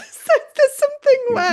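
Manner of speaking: laughing as she speaks. Real, not acted. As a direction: laugh through the words. Laughs about the paint.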